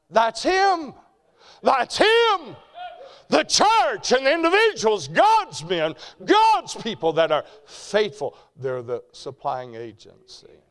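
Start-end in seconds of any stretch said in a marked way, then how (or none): 0.1-0.9 loud, high preacher's declaration; proclaiming. Real, not acted. 1.6-2.5 higher and louder shouted repeat; preaching shout. Real, not acted. 3.3-8.4 high, loud sermon cadence; fervent preaching. Real, not acted. Preaching at full force.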